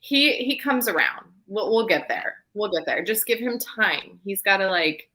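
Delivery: ordinary delivery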